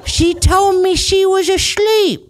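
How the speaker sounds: put-on slow, silly British accent climbing into a high pitch; hammed-up babyish Brit, drawing out the words. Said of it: "Yours is like a baby Brit".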